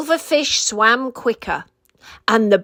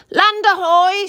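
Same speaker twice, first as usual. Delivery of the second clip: high bird squawk, calling out from lookout; 8 semitones higher, 3 dB louder than usual. A narrator's reading. Lookout bird calling out to the penguins, high squawk. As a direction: squawk it like a sea bird spotting land. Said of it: "squawked the Snow Patrol".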